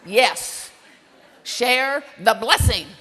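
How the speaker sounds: loud, emphatic parental order; stern command. Retold or imitated. Mother ordering her reluctant daughter to share the blessing, stern and loud. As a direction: bear down slowly and loudly on each word.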